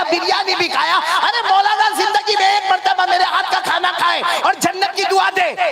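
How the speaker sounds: high-pitched rant, climbing steadily to a peak; indignant wife scolding. Acted. Wife berates husband who never blessed her cooking, acted indignation. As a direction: list his offences in a rising, chanting tirade.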